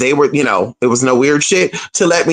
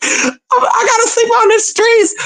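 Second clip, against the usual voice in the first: retold crying wail, way higher, half laughing; mock crying. Telling the audience she feared sleeping outside, mock crying wail. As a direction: wail it high and whiny like a hammed-up sob. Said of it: "crying and shit".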